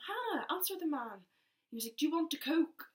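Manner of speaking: urging her to reply; prompting dad. Retold or imitated. Dad nudging Hannah to answer the cafe man.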